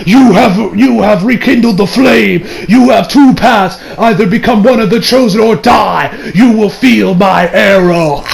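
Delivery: slow, portentous stand voice; drawn-out theatrical proclamation; spirit voice. Acted. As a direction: pronounce it like an otherworldly judge. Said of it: "his spirit gets out of his body".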